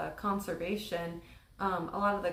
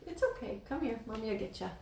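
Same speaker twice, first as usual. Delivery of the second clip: soft comforting mother; soothing mom; 3 semitones higher, 4 dB quieter than usual. Real, not acted. Mother soothing woken child.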